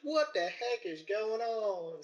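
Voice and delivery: drawn-out, high, put-on bafflement; mock astonishment. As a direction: stretch it out in exaggerated disbelief. Retold astonishment at police cars.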